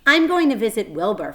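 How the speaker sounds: announcing. A narrator's reading. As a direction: announce it proudly. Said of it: "Fern announced".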